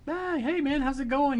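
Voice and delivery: airy casual greeting to Brian; forced casual. Retold or imitated. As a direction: act like it's no big deal. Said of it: "tried to just be totally like".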